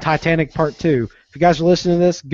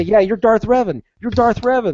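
taunting; 8 semitones higher than usual. Retold or imitated. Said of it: "I would say".

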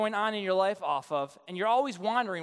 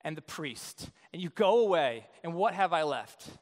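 mournful lament; 7 semitones lower than usual. Retold or imitated. Micah lamenting to the Danites, sad and low. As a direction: grieve the loss. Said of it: "I think this is so sad".

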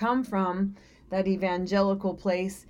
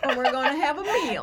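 laughing; 6 semitones higher, 3 dB louder, faster than usual. Real, not acted. Mocking church talk, laughing.

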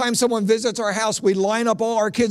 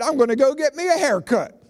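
put-on raised Southern good-old-boy reply; imitated Billy Bob; 6 semitones higher than usual. Friend walking out of the long sermon, Southern reply. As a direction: answer pitched up as a put-on country churchgoer. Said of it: "Billy Bob said".

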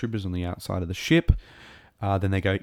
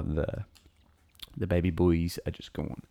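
ordinary talk

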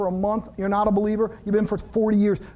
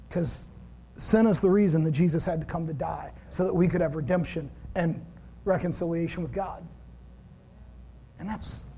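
ordinary talk, nothing marked

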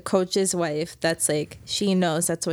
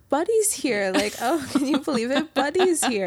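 high-pitched mock astonishment; feigned amazement, pitched up high; fake surprise; 6 semitones higher than usual. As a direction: imitate a couple putting on exaggerated surprise. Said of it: "the fakest, most surprised, funny thing".

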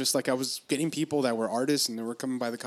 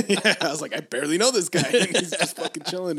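laughing; 4 semitones higher, 5 dB louder than usual. Retold or imitated. Laughing while retelling the night.

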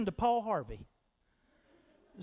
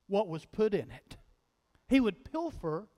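normal talking voice

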